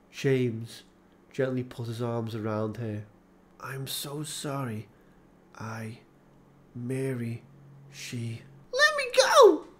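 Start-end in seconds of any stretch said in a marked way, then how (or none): 3.6-8.4 broken apology. A narrator's reading. 8.7-9.7 high loud furious cry; angry shout. A narrator's reading. Laura breaks from James's embrace, high angry shout.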